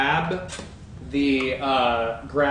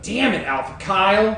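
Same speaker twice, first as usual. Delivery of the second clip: frustrated shout; 6 semitones higher, 4 dB louder than usual. Real, not acted. Player curses counterspell.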